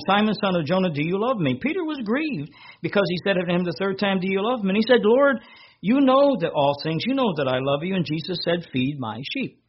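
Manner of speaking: lifted, ringing scripture voice; scripture reading. Acted. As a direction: proclaim the Gospel to the congregation. Reads third question aloud.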